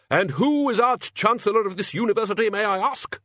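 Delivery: high, loud, blustering pomposity; indignant Archchancellor. Acted. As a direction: pull rank with booming indignation. Archchancellor asserts his authority.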